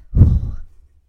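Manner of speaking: loud, deliberate deep breath; big breath to calm down. Real, not acted. Excited podcaster pausing to settle, deep loud breath. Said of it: "I'm gonna take a deep breath".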